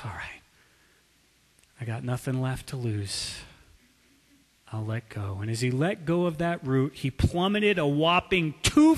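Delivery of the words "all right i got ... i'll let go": soft, weary giving in; exhausted surrender. Acted. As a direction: let it out with nothing left. Exhausted man gives up.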